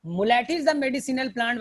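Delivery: slow, word by word; Muleठी said slowly and clearly; slow dictation. Shown as a demonstration. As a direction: let students note it down. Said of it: "teacher dictating a key fact".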